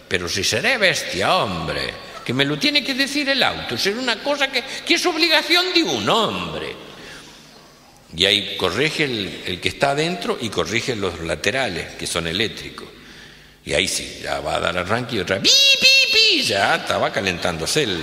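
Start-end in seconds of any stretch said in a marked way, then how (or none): 0.1-6.7 higher-pitched grumbling, slowing at the end; annoyed boss. Acted. Rich boss grumbles about the demanding car, raised pitch. 15.4-16.4 very high, loud electronic beeps; car beeping. Acted. Car alarm beeping at the boss, very high. Imitating the car's warning beep.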